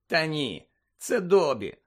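ordinary delivery, nothing marked

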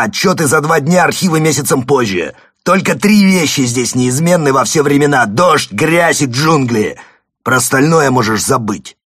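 loud, high-pitched furious tirade; angry outburst. Acted. Father pounds the table and rails at his son, angry shouting. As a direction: shout it angrily, hammering out each point.

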